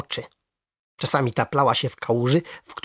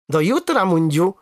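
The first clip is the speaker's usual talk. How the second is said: higher-pitched cheerful call; 4 semitones higher, 3 dB louder than usual. A narrator's reading. Boy to his frog at dusk, calling out. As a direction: call it out as a farewell. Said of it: "zawołałem".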